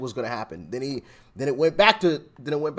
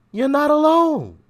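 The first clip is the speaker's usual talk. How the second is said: excited; 11 semitones higher, 5 dB louder than usual. Real, not acted. Host cheering on Tim.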